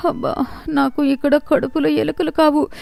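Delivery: a hungry sigh breaking toward tears; hungry wife whimpering to herself at night; whimpering groan. Acted.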